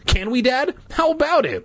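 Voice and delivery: put-on high-pitched pestering child; eager demand. A narrator's reading. Boy grabbing Dad's seat, eagerly begging. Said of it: "Luke demanded eagerly".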